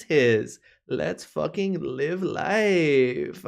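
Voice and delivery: high, slow spaced-out hippie; stoned hippie voice. Acted. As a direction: drift through it like a mellow stoner. Myconoid Sovereign speaks.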